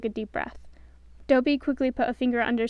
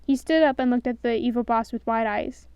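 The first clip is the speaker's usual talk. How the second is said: ordinary delivery, nothing marked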